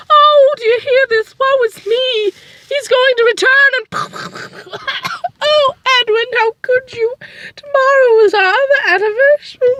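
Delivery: melodramatic wailing wife. Acted. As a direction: wail like a tragic heroine. Wounded wife wails melodramatically.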